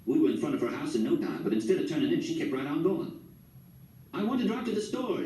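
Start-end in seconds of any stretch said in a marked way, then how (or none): 4.1-5.2 high, excited call over the motor; lightly voiced excited yell; yelled over engine. A narrator's reading. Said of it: "she yelled over the putt-putt".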